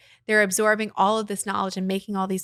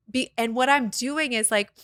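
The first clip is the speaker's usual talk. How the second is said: ordinary talk, nothing marked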